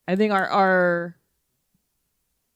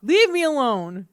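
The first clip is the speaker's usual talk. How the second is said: high, drawn-out whiny refusal; whiny protest; 7 semitones higher, much slower than usual. Real, not acted. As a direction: protest with a long, high-pitched whine. Refusing to show her eyebrows on camera.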